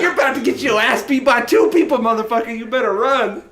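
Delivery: high, loud mock threat; acted-out chase taunt, loud and high; shouted threat, retold. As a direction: holler it after someone fleeing. Taunting man while chasing him.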